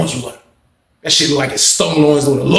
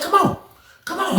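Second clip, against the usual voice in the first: very high, soft squeaky come on; squeaky taunt; 4 dB quieter than usual. Retold or imitated. BB taunting the dude to fight, very high squeaky voice. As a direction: tease him in a tiny high voice.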